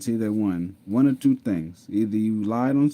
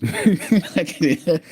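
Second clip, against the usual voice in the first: breaking into laughter mid-attempt; can't keep a straight face, laugh through it; giggling too hard to start; 9 semitones higher, 7 dB louder than usual. Real, not acted. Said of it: "I can't even do it with a straight face".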